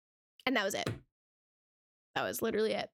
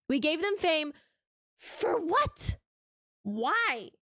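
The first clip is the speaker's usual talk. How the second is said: incredulous; 5 semitones higher, much slower than usual. Real, not acted. Exasperated question about fame.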